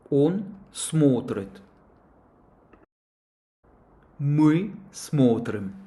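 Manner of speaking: slow, carefully articulated teacher demo; model pronunciation drill. Shown as a demonstration. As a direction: say each form slowly for students to repeat. (lecture lente et articulée, modèle pour les élèves)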